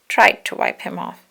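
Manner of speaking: plain reading voice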